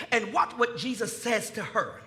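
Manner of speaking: usual speaking voice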